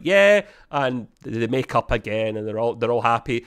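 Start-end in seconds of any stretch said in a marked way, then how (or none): 0.0-0.4 high, louder eager yeah; mock eager yes. Rutherford answers Tendi with a high, loud eager yeah. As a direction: blurt it out high and bright. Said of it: "He's like".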